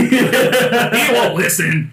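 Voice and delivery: "He won't listen" said slowly through a laugh. Real, not acted. Joking about a player's character.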